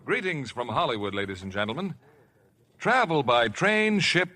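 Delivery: ordinary talk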